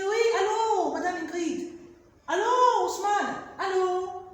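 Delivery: high, loud phone greetings; acted phone greeting. Shown as a demonstration. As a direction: greet the caller brightly.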